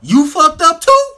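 retold high, loud blurt; laughing disbelief. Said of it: "he just started laughing".

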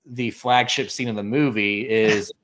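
normal talking voice

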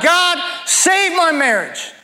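high, drawn-out plea on each SAVE; imploring God, falling away at each end; crying out to God. Acted. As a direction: cry out a desperate prayer, stretching the words. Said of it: "You'll cry out to God".

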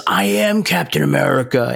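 a hint of a dark, arrogant Captain America; lightly voiced boast of a fallen hero; menacing Captain America. Host mimics John Walker's boast, lightly menacing. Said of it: "in a way that Captain America would never say it".

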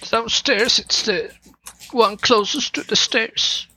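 put-on high-pitched girl's voice; Sophia voice. GM voices Sophia giving directions.

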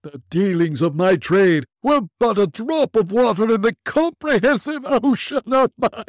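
very high, loud wailing ghost; unearthly voice. Acted. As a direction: wail your regret as a spirit.

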